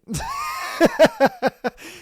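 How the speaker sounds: a shrill high squeal of laughter; high shrieking laugh. Real, not acted. Streamer mocking the cheater, shrill laugh. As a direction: break into a high cackle.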